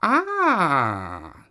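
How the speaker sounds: high knowing cat sigh; a sly cat watching. Acted. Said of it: "said the cat".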